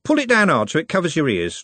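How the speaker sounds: commanding. A narrator's reading. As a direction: boss your mate about. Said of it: "Trev commanded".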